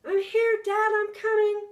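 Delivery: high loud eager shout; calling out. Acted. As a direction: shout back while running. Boy running toward his father.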